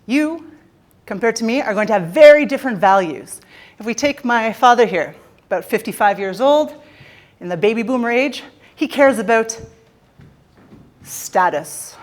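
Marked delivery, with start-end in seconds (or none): none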